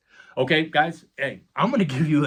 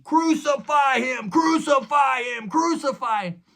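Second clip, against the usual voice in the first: much higher, louder shouted chant; crowd screaming; 12 semitones higher, 5 dB louder than usual. Retold or imitated. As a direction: shout it like a baying mob. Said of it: "the entire crowd is screaming".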